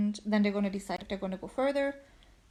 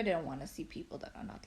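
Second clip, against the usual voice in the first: normal talking voice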